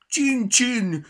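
boomed mockery. A narrator's reading. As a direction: blare "Csin, csin" at the mouse. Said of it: "harsogta Petya".

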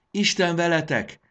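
high, loud goodbye shout; called-out farewell. A narrator's reading. Jakab waves goodbye to the crowd below, calling out. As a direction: call it down to a distant crowd.

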